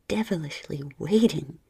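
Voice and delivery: ordinary delivery, nothing marked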